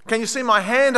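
high, mild yell for help; retold yell. Buried woman pushes hand through to rescuers, mildly yelling. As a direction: call up to someone out of sight. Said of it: "I yelled".

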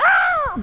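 high, loud yelp of fright; scream. Retold or imitated. Boys yelping as the aerosol can explodes, high and loud. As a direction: yell as the can blows.

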